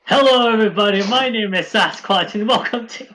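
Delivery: loud, upbeat streamer greeting; announcer voice. Pretend. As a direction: welcome the viewers like a host. Mock stream intro.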